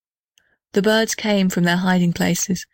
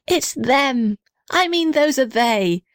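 higher, slower joyful cry; happy shout; 6 semitones higher, slower than usual. A narrator's reading. Little red house spotting Sim and Emily, joyful shout. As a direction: burst out with glad recognition. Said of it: "shouted the little red house happily".

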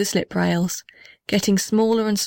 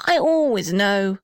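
plain reading voice

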